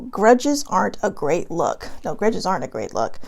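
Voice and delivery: usual speaking voice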